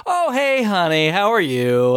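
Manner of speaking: high, sugary greeting to his wife; sweet phone voice. Retold or imitated. Paul greets his wife on the phone mid-torture, sugary high. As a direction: turn on the fake family-man charm.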